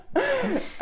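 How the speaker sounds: high burst of laughter; real laugh. Real, not acted. Hosts share a joke about a haircut, laughing.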